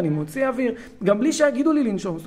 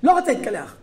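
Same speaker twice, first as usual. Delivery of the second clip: higher-pitched mock kid refusal; defiant child; 6 semitones higher, 4 dB louder, faster than usual. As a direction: act a kid digging in against a parent. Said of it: "והוא תוקף אותי". (ילד מתעקש ומסרב)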